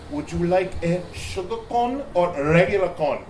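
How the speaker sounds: careful foreign student with a put-on Ugandan accent; mock African accent. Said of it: "I treated the customers as "Yamol from Uganda".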